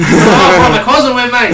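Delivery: hammed-up Aussie bloke; mock Australian accent, laughing. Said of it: "Oi ... mate".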